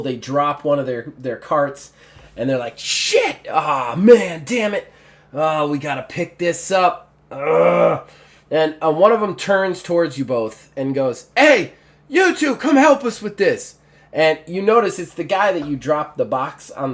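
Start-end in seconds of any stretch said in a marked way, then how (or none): 2.8-8.1 exasperated cursing, jumping high; mock exasperation with a groan; frustrated guard. Guards stumble and drop cart. 11.4-13.8 loud, high call for help; raised-voice summons from a guard. Acted. Guard calls players to help.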